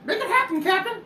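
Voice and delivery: squeaky high bossy voice. Acted. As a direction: chirp the command up high. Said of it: "He says".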